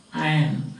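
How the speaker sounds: "Iron" as eye-un, no R; silent R. Shown as a demonstration. Teacher showing how to pronounce what is written, silent R. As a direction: drop the R in "Iron". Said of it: "There is no R pronunciation".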